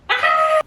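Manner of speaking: short, very high cry; high yelp. Pretend. Drinker reacting after a toast, high yelp. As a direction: let out a sudden high yelp.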